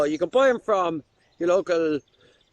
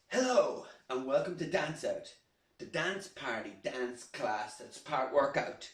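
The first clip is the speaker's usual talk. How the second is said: much softer, hushed welcome; quiet restart; 5 semitones lower, 12 dB quieter than usual. Acted. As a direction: pull it way down after the shout. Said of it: "I'm, I'm still shouting".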